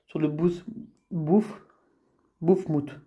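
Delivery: halting attempts at "bouffe moute", restarting after "bouffe"; struggling to name it. Real, not acted. Gamer tripping over a monster's name for viewers, struggling with "bouffe moute". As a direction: stumble on "bouffe", pause, retry it, then finish "bouffe moute". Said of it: "J'ai vraiment du mal à, à l'appeler".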